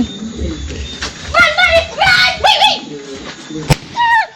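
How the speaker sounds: high loud panicked shouting; excited shout. Real, not acted. Playing the phone game and yelling at it in panic. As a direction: scream at the screen as the game goes wrong.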